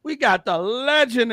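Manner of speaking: loud hype announcement of the legend; hype shout. Acted. Host hyping a legend in the chat, loud and proud. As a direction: call it out big like a hype man. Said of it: "hyping a viewer".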